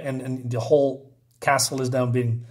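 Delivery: ordinary delivery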